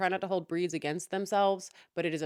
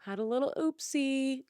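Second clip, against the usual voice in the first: polite Dennis; 6 semitones higher than usual. Retold or imitated. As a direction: tiptoe around it like a meek nice guy. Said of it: "Dennis isn't I like".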